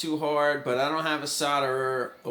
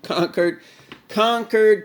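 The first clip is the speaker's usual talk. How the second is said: conquered, conquered, rising higher and louder each time; mock triumphant building; 7 semitones higher, 7 dB louder, slower than usual. Shown as a demonstration. Vlogger hams up beating his tool list, building to triumph. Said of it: "I conquered".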